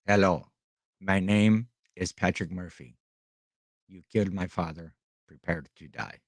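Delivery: quiet, low mock Inigo Montoya line; lightly voiced movie avenger, no accent; Princess Bride quote, plain voice. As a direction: mutter the revenge line flatly, low and soft. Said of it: "I should have done that in an Irish accent".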